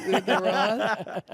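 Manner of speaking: slow, stretched New York accent on "Brandon Ross?" with a laugh. Shown as a demonstration. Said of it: "Is that my New York accent coming out?".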